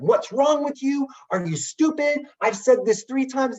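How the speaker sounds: high-pitched, drawn-out mock scolding; acting out an irate customer's tirade; imitated berating yell. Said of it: "yelling at him".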